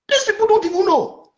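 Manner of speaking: ordinary talk, nothing marked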